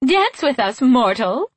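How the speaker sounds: high, lilting, mocking invitation; laughing fey taunt. A narrator's reading. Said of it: "they laughed".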